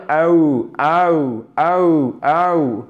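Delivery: aou gliding from a strong first sound into a lighter ou; drawn-out double sound in aou; diphthong demo. Shown as a demonstration. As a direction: slide aou slowly towards OU. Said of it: "on va vers le OU".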